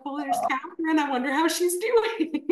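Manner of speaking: high, sing-out affectionate excitement; light up at a friend's name, pitch climbing; fond delight. Retold or imitated. Coach acting out seeing client's name pop up, delighted.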